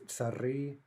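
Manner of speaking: tsari with stress on the final i; stress on the ending. Shown as a demonstration. Teacher showing the plural, tsari stressed on the ending. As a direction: slowly land on the last vowel of tsari. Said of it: "it's gonna be stressed".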